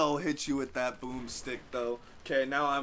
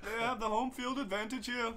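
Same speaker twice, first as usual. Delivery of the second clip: higher playful narrator voice; mock wildlife commentary; nature documentary; 8 semitones higher than usual.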